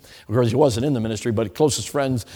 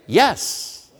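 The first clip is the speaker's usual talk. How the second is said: 高い声で即答する「Yes」; eager yes; 6 semitones higher than usual. Retold or imitated. Teen eagerly accepting a ride.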